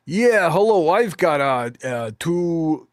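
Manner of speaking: loud, high-pitched imitation American phone voice; American accent. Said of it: "she was speaking in an American accent on the phone".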